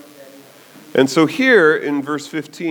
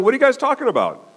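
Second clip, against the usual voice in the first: casual Jesus; 9 semitones higher, faster than usual. Retold or imitated. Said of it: "he's going".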